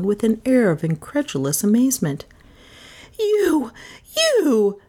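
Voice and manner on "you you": high, accusing cry; outraged shout. A narrator's reading. Melissa catching Carstairs at the food, shouting. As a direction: throw each word out high in outrage. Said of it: "Melissa shouted".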